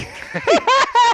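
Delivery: loud high laughter at own attempt; burst of laughter. Real, not acted. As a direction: crack up laughing mid-accent. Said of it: "I can't do".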